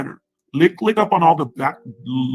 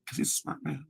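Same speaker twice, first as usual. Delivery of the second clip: normal talking voice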